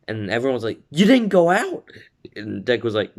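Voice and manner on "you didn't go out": high, loud incredulous question; shocked outcry. Retold or imitated. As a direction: imitate a whole class in disbelief. Acting out shocked classmates.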